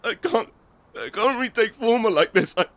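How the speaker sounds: high, tearful begging; breaking down pleading. Acted. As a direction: voice cracking as you plead. Injured man begging, breaking down.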